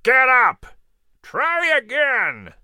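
high, commanding eagle cry, slowing at the end; calling down. A narrator's reading. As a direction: call from high above, stretching the last words. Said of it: "eagle called down to Cato".

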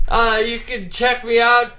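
ordinary delivery

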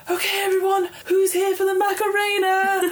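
high, bright-eyed party host call; rally the crowd like a cheesy DJ; hammed-up announcer. David calling the drunk crowd to dance, high showman voice. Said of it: "he's like".

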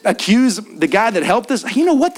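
usual speaking voice

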